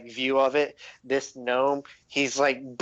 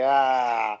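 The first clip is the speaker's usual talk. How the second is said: slow, drawn-out menace in a put-on voice; villain toying with his prey; menacing NPC; 4 dB louder, much slower than usual. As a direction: lean in and threaten him slowly. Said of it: "He's like".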